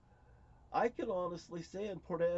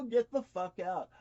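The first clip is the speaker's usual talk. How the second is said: louder, forceful command; raised-voice dismissal; retold angry order; 2 semitones higher, 6 dB louder than usual. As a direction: throw the order out hard. Acts ordering niece out of room.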